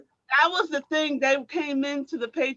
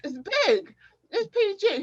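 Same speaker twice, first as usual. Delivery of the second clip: protesting athletes; 8 semitones higher than usual. Retold or imitated. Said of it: "And we're like".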